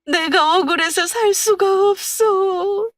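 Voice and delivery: high, slow, sobbing lament poured out to a husband; wailing cry. A narrator's reading. Widow wailing at her husband's urn, high slow sobbing lament. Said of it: "목을 놓아 울었습니다".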